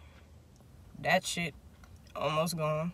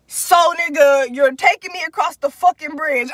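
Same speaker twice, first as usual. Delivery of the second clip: losing it; 5 semitones higher, 7 dB louder than usual. Retold or imitated. Retelling her confronting him about the bridge, losing it. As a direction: punch it out in retold disbelief. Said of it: "I started losing it".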